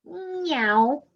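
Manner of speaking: high drawn-out falling meow; cat's slow meow; long cat meow. Acted. Cat meows.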